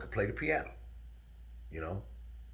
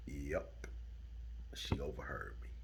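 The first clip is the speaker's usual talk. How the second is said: hushed aside; 11 semitones lower, 16 dB quieter than usual. A narrator's reading. Grim aside in the story.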